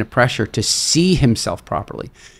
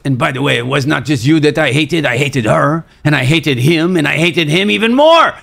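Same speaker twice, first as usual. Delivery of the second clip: retold rant climbing higher and louder; really angry; 5 semitones higher, 4 dB louder than usual. French climber tells the group whom else he hated, angrily. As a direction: build his angry list to a loud peak. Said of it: "really angry".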